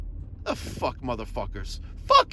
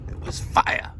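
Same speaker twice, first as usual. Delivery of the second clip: slang quote; slower than usual. Retold or imitated. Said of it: "As some of my brethren would say".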